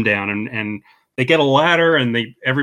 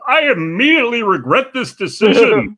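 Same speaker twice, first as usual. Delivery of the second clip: mock news anchor regret, higher and louder; Ron's deadpan line; 8 semitones higher, 4 dB louder than usual. Podcaster quoting Ron surrounded by bears, mock anchor voice. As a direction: quote Ron like a pompous anchor in over his head. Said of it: "he just goes".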